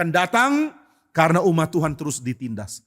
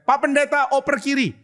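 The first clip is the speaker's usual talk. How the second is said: teasing call across field; 10 semitones higher than usual. Retold or imitated. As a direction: call out to him across the pitch with a jeer. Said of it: "mereka teriak". (teriakan mengejek yang lebih tinggi)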